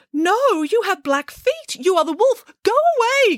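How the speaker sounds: very high piglet squeal; crying out. A narrator's reading. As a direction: cry out in alarm as little piglets. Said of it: "cried the piglets".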